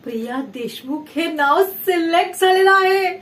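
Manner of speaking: excited announcement. Acted. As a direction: build up to a loud, proud finish. Announcing good news after "guess what. (उत्साहात आनंदाची बातमी जाहीर करत)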